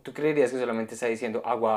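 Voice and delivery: normal talking voice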